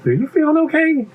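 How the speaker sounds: high-pitched concerned question; puzzled little pea. Acted. Pea checks on buzzing bee, puzzled. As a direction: ask it high, baffled.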